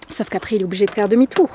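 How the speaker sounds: ordinary talk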